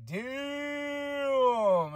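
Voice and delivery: high, stretched-out damn in awe; a drawn-out impressed gasp of a word; awed damn. Retold or imitated. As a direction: let it ring out, amazed. Reacting to the finish.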